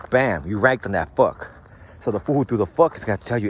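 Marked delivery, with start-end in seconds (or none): none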